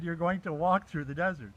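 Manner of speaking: "You're going to walk through the desert" stated with a falling end; retold pronouncement, "desert" falling like a full stop; statement, not question. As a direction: let "desert" drop, no question in it. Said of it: "He didn't say it like a question".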